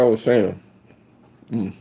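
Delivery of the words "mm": usual speaking voice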